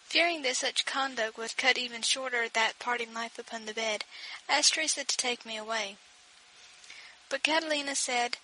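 usual speaking voice

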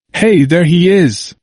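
put-on bright, slow hello to an old friend; cheerful greeting. A narrator's reading. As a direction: greet him with sudden cheer. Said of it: "He sounded cheerful".

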